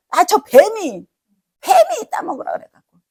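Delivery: high, whiny mock excuse; blame-shifting excuse. Woman blaming the snake to God, whiny excuse. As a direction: pitch it way up and pass the blame.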